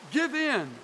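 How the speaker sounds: high loud cry of give in. Retold or imitated. Preacher quoting Moffat's translation, loud high cry. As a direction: call it out like a plea. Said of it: "he cries".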